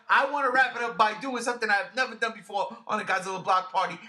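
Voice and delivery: usual speaking voice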